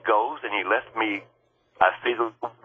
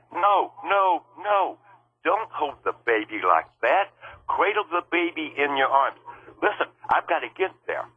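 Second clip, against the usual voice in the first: pitched-up insistence to stop; urgent retold protest; 7 semitones higher than usual.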